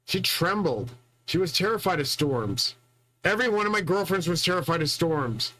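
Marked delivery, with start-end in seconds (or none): none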